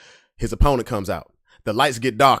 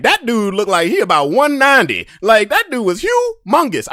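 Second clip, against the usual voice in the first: higher, louder disbelief; higher, louder, stunned; incredulous; 10 semitones higher, 5 dB louder than usual. Retold or imitated. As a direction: call out how huge he is. Sizing up huge opponent.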